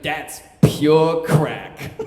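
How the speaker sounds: slow, loud, imitated New York boss; drawn-out New York brag; New York accent. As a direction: announce it proudly in a New York accent, stretching it out. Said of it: "he talked like he had a New York accent stuck in his throat".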